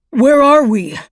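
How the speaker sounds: high, loud shout over wind. Acted. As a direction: yell against a gale. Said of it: "he shouted over the wind".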